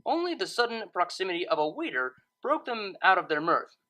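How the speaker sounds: ordinary delivery, nothing marked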